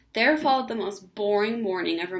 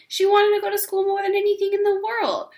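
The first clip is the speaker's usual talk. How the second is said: higher and louder, pleading; emphatic longing; 10 semitones higher, 7 dB louder than usual. A narrator's reading. Ramona insisting she truly wanted school, higher and louder. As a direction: insist with all her heart.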